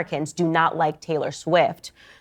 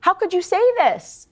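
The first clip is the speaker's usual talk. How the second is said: much higher, louder pleading fan voice; mock betrayed fans; 13 semitones higher, 3 dB louder, slower than usual. Podcaster mimics Swifties questioning [name], high mock dismay. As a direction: play a heartbroken fan begging an answer. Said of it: "The Swifties were like".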